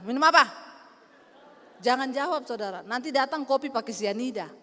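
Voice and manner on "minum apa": loud, abrupt question; mock-unfriendly, short and forceful; curt demand. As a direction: fire it out like a rude hostess. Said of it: "Coba kalau ditanya".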